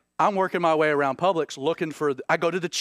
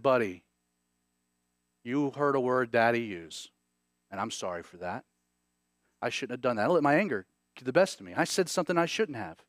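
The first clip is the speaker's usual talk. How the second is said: put-on softer, lower, tender apology to a small boy; gentle apology; 7 semitones lower, 5 dB quieter than usual. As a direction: kneel down and say sorry gently. Father apologizing to little son.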